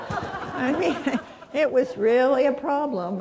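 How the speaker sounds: laughing through the words. Real, not acted. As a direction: let real laughter carry the line. Laughing while recounting husband's troubles.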